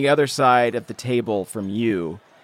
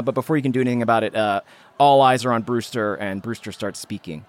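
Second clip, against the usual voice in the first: usual speaking voice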